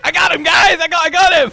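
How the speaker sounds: high, loud excited yell; excited shout. Retold or imitated. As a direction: yell it in triumph. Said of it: "who was he like".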